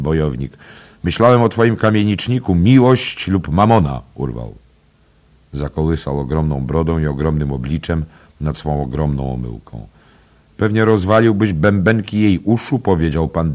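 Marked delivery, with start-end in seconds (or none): 1.0-4.0 fast, higher, louder banter; lively teasing. Acted. Father Cowley ribs Ben Dollard, quick and loud. 10.6-12.8 louder, higher joking jab; hearty jest. Acted. Mr Dedalus teases Ben about his voice, loud and hearty.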